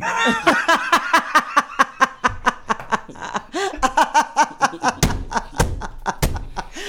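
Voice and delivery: long, high, helpless belly laugh; real laughing fit. Real, not acted. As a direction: crack up at your own punchline. Cracking up at own punchline.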